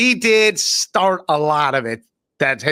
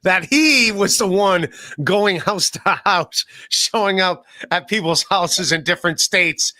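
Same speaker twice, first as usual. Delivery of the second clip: usual speaking voice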